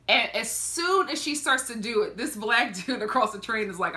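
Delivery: normal talking voice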